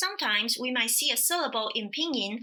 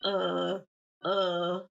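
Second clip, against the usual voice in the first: low, level, drawn-out grunts of uh; sit each one at the bottom of your pitch; lowest range; 5 semitones lower, much slower than usual. Shown as a demonstration. Teacher showing third tones in a row, low uhs. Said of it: "sounds at your lowest range".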